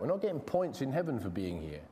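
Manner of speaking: normal talking voice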